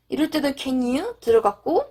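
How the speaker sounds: ordinary talk, nothing marked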